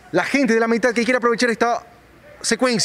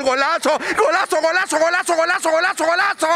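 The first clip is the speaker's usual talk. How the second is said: goal commentary; 5 semitones higher than usual. Real, not acted. Goal called in live commentary.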